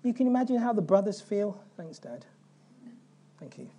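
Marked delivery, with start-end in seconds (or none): 1.8-3.7 much softer sarcastic aside, lower; sarcastic thanks. Retold or imitated. Brothers mutter sarcastic thanks to their guilt-tripping father. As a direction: mutter the thanks under your breath, bitterly.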